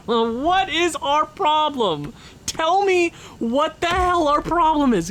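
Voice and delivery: slow, high, half-laughing outrage; exasperated rant. Real, not acted. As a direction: drag out the disbelief. Ranting at losing team.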